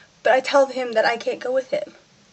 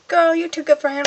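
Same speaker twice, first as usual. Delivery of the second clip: friends' voice; 7 semitones higher, 3 dB louder than usual. Retold or imitated. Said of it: "they're like".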